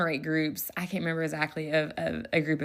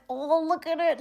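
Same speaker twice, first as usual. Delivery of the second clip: gushing delight; 10 semitones higher than usual. Retold or imitated. Teacher gushing over shy kids.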